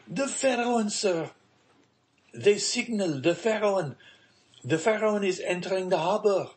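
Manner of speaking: high-pitched, urgent jubilation; excited announcement. A narrator's reading. Emmanuel insists on joyful news.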